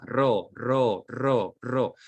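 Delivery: ro repeated slowly, syllable split off clearly. Shown as a demonstration. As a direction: tap out ro as a single syllable. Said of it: "a segmentar la palabra rosa".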